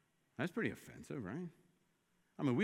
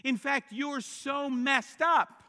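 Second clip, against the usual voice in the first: louder, higher, emphatic; preaching at full force; 8 semitones higher, 8 dB louder than usual. Acted. Preacher at full force telling the congregation self-help fails. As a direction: drive it home from the pulpit.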